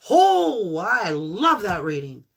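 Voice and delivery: thrilled. Real, not acted. Reader thrilled by reading.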